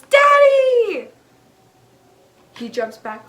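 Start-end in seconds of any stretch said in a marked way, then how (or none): 0.0-1.1 put-on high, very loud drawn-out child's cry; sudden shout. Doll son startles dad at his touch, shouting. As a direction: scream it out like a doll sprung to life.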